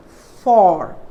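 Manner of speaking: "for" drawn out with full O; over-clear "for", the sound to avoid; wrong sound. Shown as a demonstration. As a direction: stretch "for" as a long FAWR. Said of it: "Don't use".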